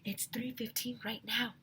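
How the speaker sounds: put-on a girl whispering the time; whispered. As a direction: whisper it so no one hears. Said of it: "whispered Andrea".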